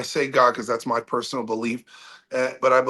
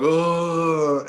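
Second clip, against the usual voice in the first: long drawn-out moan, slightly higher; imitated moaning; 4 semitones higher than usual. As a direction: imitate a crowd groaning in one long "oh". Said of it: "all these people moaning".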